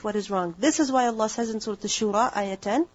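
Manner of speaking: usual speaking voice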